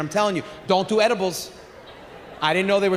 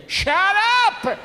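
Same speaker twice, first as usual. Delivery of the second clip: father yelling shut up; 11 semitones higher than usual. Retold or imitated. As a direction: bark it to silence everyone. Father yells again.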